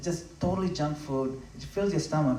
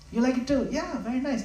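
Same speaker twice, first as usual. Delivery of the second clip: higher-pitched imitation of a Mombasa devotee accent; 9 semitones higher than usual. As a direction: lightly mimic the Mombasa devotees' accent, delighted. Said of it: "they'll say it in a different accent".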